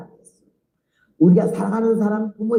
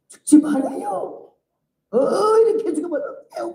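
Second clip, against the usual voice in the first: weeping plea; 10 semitones higher than usual. Retold or imitated. Praying deaconess wails over the reviving patient, high crying plea. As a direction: wail it like she is losing you. Praying woman begs her not to die.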